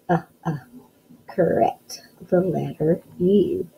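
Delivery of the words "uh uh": "Uh, uh" as two short separate sounds; isolated vowel sound. Shown as a demonstration. Teacher sounding out umbrella, short separated "uh, uh". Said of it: "What's the first sound you hear".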